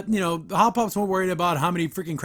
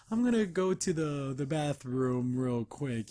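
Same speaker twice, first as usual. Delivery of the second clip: slow, hesitant, trailing softer; stalling excuse; 3 semitones lower, 4 dB quieter, slower than usual. Retold or imitated. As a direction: drag it out as if making up an excuse. Anne makes an excuse to leave.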